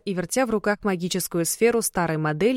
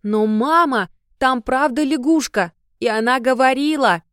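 put-on loud high insistent little girl; insist, almost whining; insistent child; 6 semitones higher, 6 dB louder than usual. A narrator's reading.

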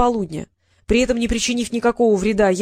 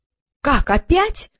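higher-pitched wail of protest, rising sharply; dismayed cry; 5 semitones higher, much slower than usual. A narrator's reading. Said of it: "завопил Трикс".

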